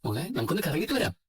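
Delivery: put-on high-pitched cooing to a child; playful, lifted coaxing voice; coaxing baby talk. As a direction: sweet-talk a toddler into coming along. Father coaxing child away.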